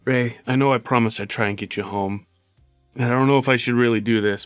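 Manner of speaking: ordinary talk, nothing marked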